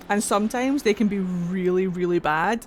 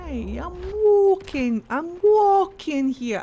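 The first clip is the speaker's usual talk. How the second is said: high, drawn-out "walking", swelling louder; mock indignant protest; 9 semitones higher, 4 dB louder, slower than usual. Player objecting while walking in the game, mock indignant. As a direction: protest high and slow, stretching each "walking".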